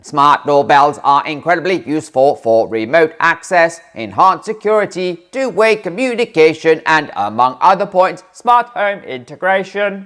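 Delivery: robot voice. Pretend. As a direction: read it out like a robot, loudly. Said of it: "that's my robot voice".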